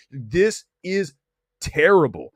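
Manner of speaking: ordinary talk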